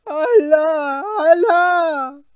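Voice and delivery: high, loud terrified wail; trembling cry. Acted. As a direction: cry out to God in terror. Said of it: "erbleichend und zitternd vor ihm stand".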